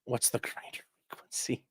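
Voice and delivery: unvoiced hushed whisper. Real, not acted. Reading a chat question, whispered softly. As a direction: breathe it out in a whisper.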